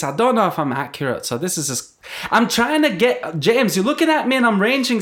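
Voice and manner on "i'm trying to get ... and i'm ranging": put-on lightly northern English accent, a bit higher; a vague northern accent, like the Yorkshire teacher; Yorkshire teacher impression. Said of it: "She used to talk like this".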